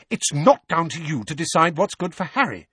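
high, sharp rebuke; stern motherly reprimand. A narrator's reading. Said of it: "said Missus Weasley sharply".

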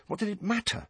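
ordinary delivery

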